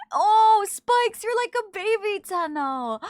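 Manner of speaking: high, loud excited gush over a new player; excited squeal. Real, not acted. Streamer delighting in a viewer's new Tenno, excited squeal.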